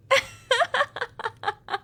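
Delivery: loud, high peal of laughter falling away; triumphant vampire laugh. Acted. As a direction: laugh in triumph over your prey.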